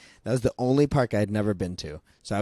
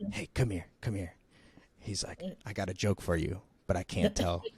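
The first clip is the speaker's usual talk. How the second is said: hushed aside; 3 semitones lower, 9 dB quieter than usual. Retold or imitated. Driver calls her aside secretly.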